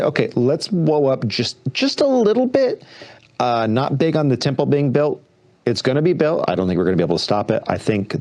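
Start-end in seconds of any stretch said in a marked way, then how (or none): 0.0-2.8 high, silly mocking pitch; squeaky, sarcastic eagerness; mock eager voice. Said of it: "Someone has to say".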